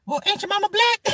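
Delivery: put-on older woman's sass. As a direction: throw it back at him like an auntie. Voicing the older woman bargaining.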